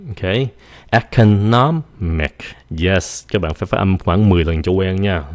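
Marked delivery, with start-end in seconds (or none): 0.9-2.4 Economic slowly split, ec-o-NOM... ic; slow syllable-by-syllable Economic; stress on NOM. Shown as a demonstration. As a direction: punch NOM in "Economic", pause before ic. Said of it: "nhấn âm nóm".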